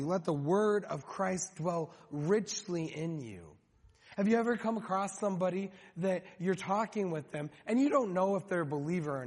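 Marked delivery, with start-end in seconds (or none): 0.0-3.5 slow emphatic reading. Shown as a demonstration. Preacher slowly reading the verse to the congregation. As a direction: read the verse slowly, weighing each word. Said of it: "this phraseology".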